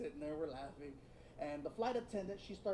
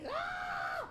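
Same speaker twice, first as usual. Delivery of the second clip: high mock yelp of laughter; imitated laughing yelp. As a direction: squeal like a cracking-up teen. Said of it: "he is dying".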